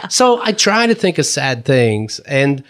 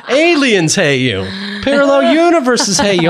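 mock leader; 3 semitones higher than usual. He exaggerates the leader's escalation, mock grand proclamation. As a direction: parody the guru, stretching the words.

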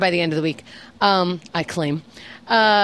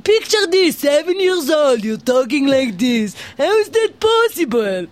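loud, higher hammed-up Israeli accent; mimicked Israeli accent; 6 semitones higher, 4 dB louder than usual. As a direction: blare it in a caricatured Israeli accent. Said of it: "he always talks like this".

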